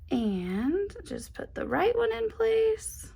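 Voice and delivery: slow, soft, careful murmur; concentrating softly. Real, not acted. Ironing pieces on, slow soft focused murmur.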